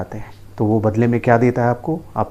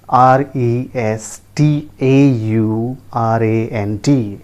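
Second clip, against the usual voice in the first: normal talking voice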